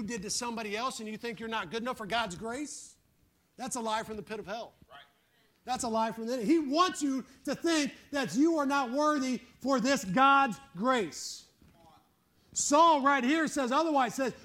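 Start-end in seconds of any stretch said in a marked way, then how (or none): none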